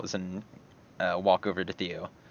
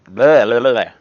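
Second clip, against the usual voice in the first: loud burst of tongue-tied blah-blah; loud babble; 3 semitones higher, 14 dB louder than usual. Real, not acted. Stumbling over where Sarah stands, loud babble. Garbles words, jokey babble.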